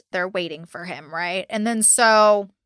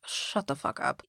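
much softer, lower, muttered under breath; muttered retort; 6 semitones lower, 11 dB quieter than usual. Retold or imitated. Host mock-answering Casey on the recording, muttered. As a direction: mutter it like an aside to yourself.

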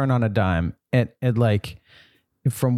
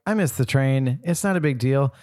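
higher-pitched, cheerful self-talk; upbeat; 6 semitones higher than usual. Retold or imitated. Commuter cheering themselves up after missing the train, upbeat. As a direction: shrug it off, then rise into delight.

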